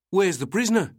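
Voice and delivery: high, loud, alarmed question; shocked officer crying out on a train. A narrator's reading. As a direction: cry it out in shock. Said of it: "Mason cried".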